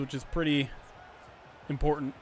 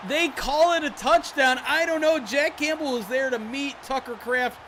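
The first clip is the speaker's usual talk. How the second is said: excited play-by-play; 9 semitones higher, 5 dB louder than usual. Real, not acted. Close goal-line touchdown call.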